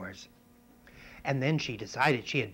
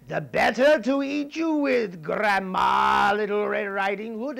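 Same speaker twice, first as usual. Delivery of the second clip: higher, louder hammed-up pounce; spring the trap with glee; wolf's threat; 8 semitones higher, 10 dB louder, slower than usual. Wolf about to eat the girl, loud and hammed-up.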